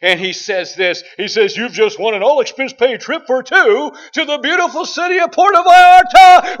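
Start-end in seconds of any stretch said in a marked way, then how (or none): 1.6-6.6 booming, much louder and higher announcer voice; game show host voice. Retold or imitated. Game show host announcing a prize to the contestant, booming and excited. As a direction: sell the prize like a big TV host. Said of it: "he has this really great voice".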